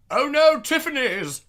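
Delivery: loud, high-pitched mock horror; hammed-up alarmed yell; mock panic. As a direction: cry out as if fleeing in terror. Pretends to flee from Tiffany's.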